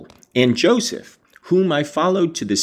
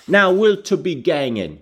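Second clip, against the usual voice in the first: put-on higher-pitched, commanding little kid; authoritative child; 7 semitones higher than usual. A narrator's reading. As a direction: demand it like a bossy boy with a country accent. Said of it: "he asked authoritatively".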